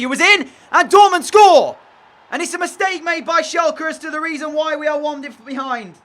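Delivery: loud, high cry of dismay as the goal goes in; anguished shout. Real, not acted. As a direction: yell it out in disbelief. Conceding a goal, crying out.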